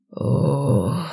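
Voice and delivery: noisy shocked exhale; shaky gasp. A narrator's reading. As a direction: breathe out in dismay. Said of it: "шумом выдохнул". (шумный испуганный выдох)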